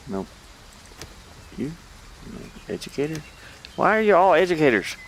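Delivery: ordinary talk, nothing marked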